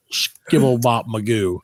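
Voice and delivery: ordinary talk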